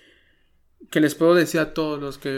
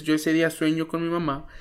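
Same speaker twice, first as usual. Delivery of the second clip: ordinary delivery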